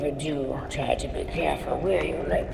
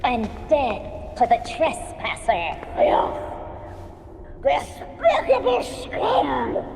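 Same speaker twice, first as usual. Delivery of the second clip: angry shouting; 7 semitones higher than usual. Acted. Crowd calls for killing.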